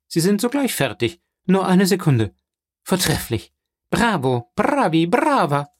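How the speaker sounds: high, over-the-top enthusiasm with hummed ta ta ta; gushing delight. Acted. Banker gushes over daughter's singing.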